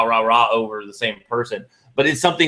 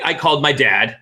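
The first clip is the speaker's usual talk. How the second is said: ordinary talk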